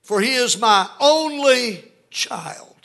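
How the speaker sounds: high, loud, stretched cry of a father; pleading father. Acted. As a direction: cry it out slowly as a desperate father. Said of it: "You hear that in the father's voice".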